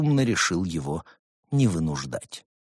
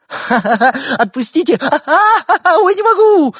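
much higher, loud hooting laugh; helpless laughter; 12 dB louder than usual. A narrator's reading. Tickled gopher begging to be let go, high loud laughter. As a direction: cackle while begging them to stop. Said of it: "Суслик захохотал".

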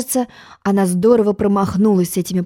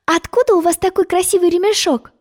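sudden exclamation; 10 semitones higher than usual. A narrator's reading. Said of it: "неожиданно воскликнула".

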